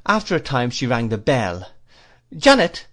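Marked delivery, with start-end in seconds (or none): 2.4-2.9 much higher, louder call across the house; calling the servant. A narrator's reading. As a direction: summon the maid sharply. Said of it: "said my aunt".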